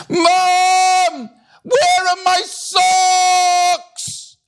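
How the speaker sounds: very high, long-drawn yell; panicked scream. A narrator's reading. Millie searching for lost socks, screaming for her mum. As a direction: wail it out, stretching every word. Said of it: "Millie screamed as she ran".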